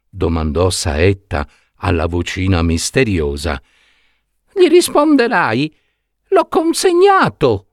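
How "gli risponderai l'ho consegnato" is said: tiny voice, much higher, begging; thin little pleading voice. Acted. Trapped prince begging Saetta not to deliver him, tiny thin pleading voice. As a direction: plead from inside the box, small and thin. Voice in the box answers.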